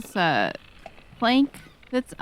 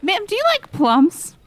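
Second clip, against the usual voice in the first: higher, slow and sly; coy question; 10 semitones higher, 3 dB louder, slower than usual. Acted. Aster probes the barkeep about cargo, sly higher voice. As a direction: ask it innocently.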